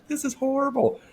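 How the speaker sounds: high, comic wail of dismay; retold misery. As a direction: lament it in an exaggerated high voice. Acting out his misery in hospital.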